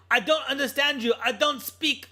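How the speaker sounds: thick mock German accent, high; imitating a thick German accent. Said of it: "in a thick German accent".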